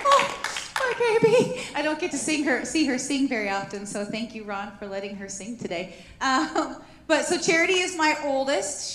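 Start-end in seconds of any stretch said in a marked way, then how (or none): 0.1-1.6 high, loud doting cry; tender gush. Retold or imitated. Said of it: "I was like". 6.2-8.9 teary laugh. Real, not acted. Teary mother after daughter sings.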